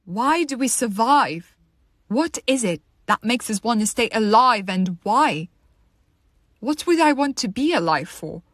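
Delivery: loud, urgent existential plea; impassioned questioning. Acted. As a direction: demand answers, pushing the key words hard. Monologue builds to desperate questioning.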